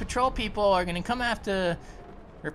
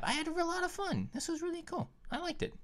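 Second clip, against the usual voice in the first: much softer, almost under breath; quiet aside; 6 semitones higher, 12 dB quieter than usual. Real, not acted. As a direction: tuck it away quietly. Outro lines said quietly.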